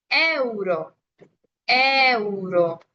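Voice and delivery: euro drawn out, e and u separate; euro vowel by vowel. Shown as a demonstration. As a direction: stretch euro so each vowel is heard. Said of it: "pronunciando vocal por vocal".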